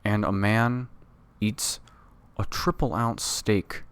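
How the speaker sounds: lightly voiced slow tough guy; a hint of a gruff cartoon cop bragging; Joe Swanson impression. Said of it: "That's my Joe Swanson".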